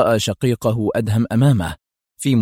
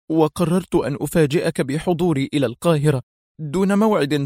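high-pitched, distressed account; agitated; 6 semitones higher than usual. A narrator's reading. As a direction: recount it with pent-up agitation. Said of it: "وهو يرتجف من الانفعال". (سرد منفعل بنبرة مرتفعة)

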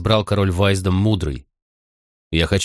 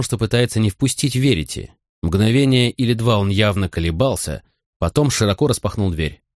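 plain reading voice

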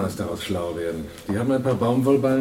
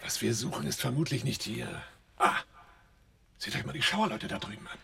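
whisper; 4 semitones higher, 11 dB quieter than usual. Acted. Sneaking up on the dock workers.

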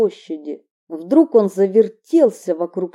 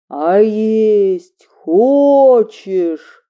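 menacing chorus; 6 dB louder, much slower than usual. Acted. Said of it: "спрашивают хором".

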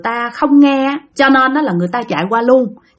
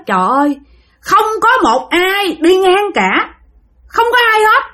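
despairing cry; 8 semitones higher than usual. Retold or imitated. Girl despairs, nobody passing.

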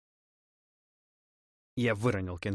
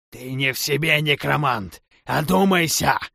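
louder, higher, urging him to stop; alarmed shout; 5 semitones higher, 11 dB louder than usual. Acted. Opponent yells at the necromancer to come to his senses, loud and high. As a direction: snap him out of it with a loud cry.

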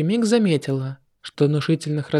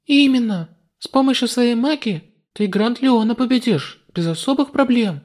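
put-on higher girlish voice; young heroine, relieved; girl's voice; 8 semitones higher than usual. (звонкий девичий голос)

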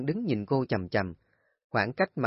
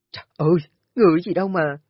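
exasperated outburst; 6 semitones higher, 8 dB louder, much faster than usual. Acted. Kim nearly falls, exasperated.

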